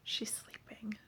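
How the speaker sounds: hushed whisper, unvoiced. Real, not acted. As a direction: whisper so she stays asleep. Daughter asleep nearby.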